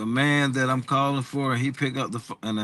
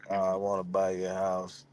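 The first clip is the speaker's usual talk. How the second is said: mock gloomy, lower and softer; depressed caller; 7 semitones lower, 8 dB quieter than usual. Shown as a demonstration. Caller opening a sales call in a mock depressed voice. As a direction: demo a sad-sack sales call. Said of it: "Sound like you was about to cry".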